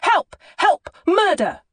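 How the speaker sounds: high, loud panicked cries for help; top-of-the-lungs child's yell; child shouting. Acted. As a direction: scream for help like a terrified boy. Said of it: "shouting at the top of his voice".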